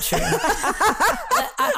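quick words dissolving into a high laugh; laughing agreement. Real, not acted. Host laughing while agreeing with guest's point. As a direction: laugh as you agree fully.

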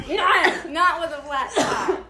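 laughing through the words, high and loud. Real, not acted. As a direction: chuckle the denial out, slowly. Laughing at the flat tire joke.

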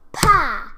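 sudden, high, explosive "pah"; loud slap noise. A narrator's reading. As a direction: voice the smack of a slap. Fairy's slap, sounded out.

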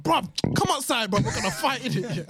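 angry. Retold or imitated. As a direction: call him out like picking a fight. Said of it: "Luke was talking to me like he was like".

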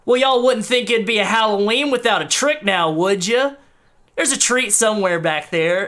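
a grinning governor working the crowd; Southern showman. Acted. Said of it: "And he goes".

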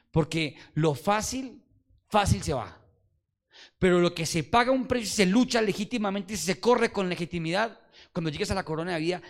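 fervent preaching. Acted. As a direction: build the point with fervour. Preaching climax.

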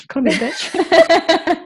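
laughing, high and loud, slow. Real, not acted. Friend laughs off a joke about the dog's couch. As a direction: laugh through the mock insult. Mock offended laughing reply.

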